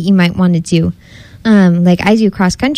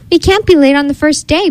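higher, urgent storybook exclamation; exclaiming; 8 semitones higher than usual. A narrator's reading. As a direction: exclaim like a cub afraid of being late. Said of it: "Bee Bear exclaimed".

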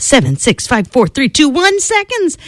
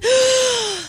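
very high, strained inhale; exhausted dragon sucking in breath; high gasp; 5 dB quieter than usual. Acted. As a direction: dragon gulping air. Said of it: "took another huge breath".

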